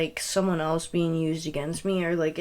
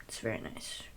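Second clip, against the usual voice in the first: whispered sarcastic aside; 8 semitones lower, 16 dB quieter than usual. Retold or imitated. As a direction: breathe it out, mock-pleased.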